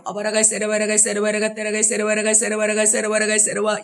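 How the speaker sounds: rapid steady babble on one pitch; speaking in tongues. Shown as a demonstration. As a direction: rattle off syllables without stopping. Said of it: "전도사님 한번 잠깐 해볼게요". (빠르고 단조로운 방언 시연)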